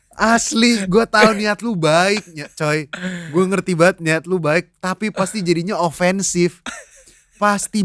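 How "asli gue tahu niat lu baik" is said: ordinary talk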